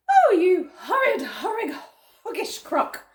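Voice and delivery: high, outraged monkey cry. Acted. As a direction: shout abuse as an indignant little monkey. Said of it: "cried Mugglewump".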